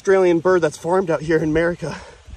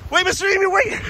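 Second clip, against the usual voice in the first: high, pleading call after a runaway; 10 semitones higher than usual. Real, not acted. Man to fleeing emu, urgently calling out. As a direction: call to stop an animal getting away.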